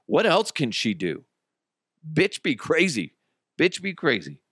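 normal talking voice

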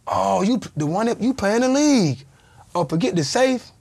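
loud, high retold outburst; excited recognition. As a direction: imitate someone suddenly recognising a star, voice jumping up.

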